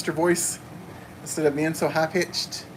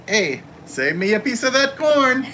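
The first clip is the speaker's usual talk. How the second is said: high, louder, slow character voice; movie quote impression; 9 semitones higher, 5 dB louder, slower than usual. Shirt's movie catchphrase voiced in a high silly voice. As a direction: ham up the movie line. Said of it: "he says that in the movie".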